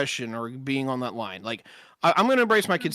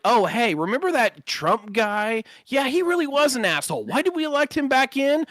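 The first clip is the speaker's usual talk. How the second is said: higher, lightly mocking voters' voice; mocking future voters; 6 semitones higher than usual. Mocking future voters who regret their choice, higher mock voice. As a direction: parody the people who finally caught on. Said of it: "and everyone's like".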